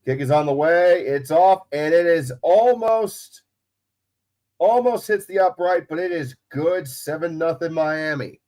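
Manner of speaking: sports announcer recapping the play. Real, not acted. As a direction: announce the score with broadcaster's poise and lift. Announces the score and kick.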